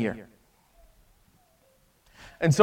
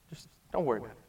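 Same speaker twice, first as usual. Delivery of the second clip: much softer and lower, almost to nothing; hushed forgiveness; 8 semitones lower, 14 dB quieter, much faster than usual. Acted. Master forgiving the slave, near-silent reassurance. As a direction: breathe it out, barely there. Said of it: "He said".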